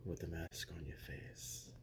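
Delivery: mocking whisper. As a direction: lean in and whisper the insult. Menacing promo, hushed aside.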